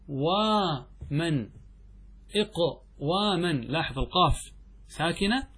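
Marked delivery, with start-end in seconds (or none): 0.1-3.5 إقواما drawn out slowly, said twice; slow demo form. Shown as a demonstration. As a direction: stretch إقواما out like dictating. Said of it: "والأصل".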